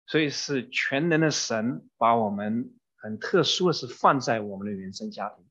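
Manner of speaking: ordinary talk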